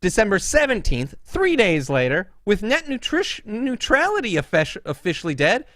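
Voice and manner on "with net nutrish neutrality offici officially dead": high, punched mock-excited reading; incredulous read-out. Host exposing Ed Tomasi's copied tweet, incredulous. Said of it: "he says".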